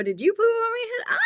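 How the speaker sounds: high cartoon animal voice; high character voice. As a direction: squeak it like a puzzled animal. Voicing animals in kids' book.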